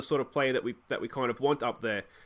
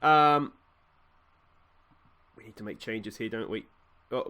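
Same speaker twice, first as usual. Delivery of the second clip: ordinary delivery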